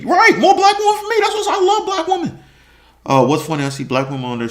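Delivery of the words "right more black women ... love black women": high laughing voice. Real, not acted. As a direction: laugh through the words, pitched up. Laughs at own joke.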